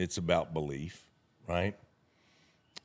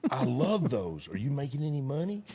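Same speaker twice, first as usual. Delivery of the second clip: perky, higher-pitched enthusiasm; cheery kid; 5 semitones higher than usual. Retold or imitated. Kid reacts to hearing about a pyramid, cheery. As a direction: imitate an eager young guy. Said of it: "Joe Cheery, right".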